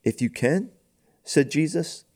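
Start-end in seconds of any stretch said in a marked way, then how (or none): 0.0-0.7 "can" lifted high into a question; higher-pitched "if you can", rising sharply on "can"; questioning tune. A narrator's reading. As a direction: ask it back, pitch climbing on "can". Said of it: "question mark".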